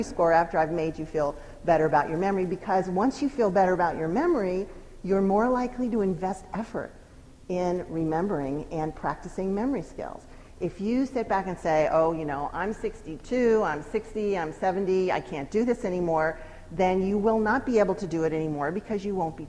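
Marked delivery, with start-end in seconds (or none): none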